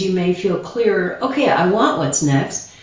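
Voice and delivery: normal talking voice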